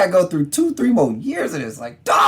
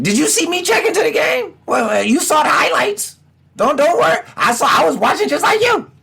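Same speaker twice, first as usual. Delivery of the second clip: mock defensive fan; 13 semitones higher than usual. Fan insisting he was only watching the game, high defensive. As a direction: talk yourself out of being mad, high.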